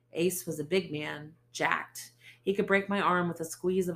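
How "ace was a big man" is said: ordinary delivery, nothing marked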